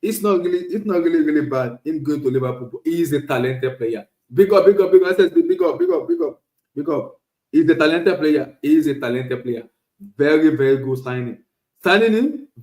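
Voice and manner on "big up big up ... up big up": loud, high, chanted big ups; excited shout-out. Real, not acted. Vlogger giving a chat viewer a loud excited shout-out. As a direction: hype the viewer up, repeating it.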